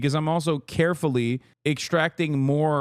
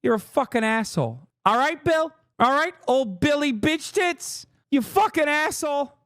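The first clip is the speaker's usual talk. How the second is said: higher, mock insulting rant; hammed-up jab at a pompous host; lightly voiced Bill Burr mocking Bill; 10 semitones higher than usual. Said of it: "even he's like".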